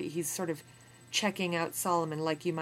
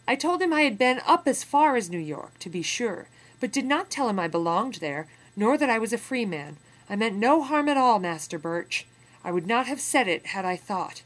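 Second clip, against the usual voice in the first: a louder, higher, urgent plea; anxious pleading; 5 semitones higher, 9 dB louder than usual. Acted. Captive pleading with Birch, urgent and anxious. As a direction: plead quickly to calm the master. Solomon pleading with his captor.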